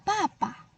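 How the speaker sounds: papa said high and clear; drawn-out papa. Shown as a demonstration. Teacher gives pupils a memory word, clearly. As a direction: say papa like calling dad. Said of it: "kamu boleh ingat".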